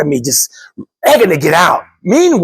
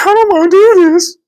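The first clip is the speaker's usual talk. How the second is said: high, whiny, frightened wife voice; mock scared wife; 14 semitones higher, 5 dB louder than usual. Nervous wife refusing the ride, high whiny mock fear. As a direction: whimper it like the terrified wife. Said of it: "She's like".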